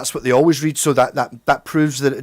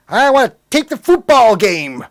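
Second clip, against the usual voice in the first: mock American; 7 semitones higher, 6 dB louder than usual. Mocking Americans wanting long tapes.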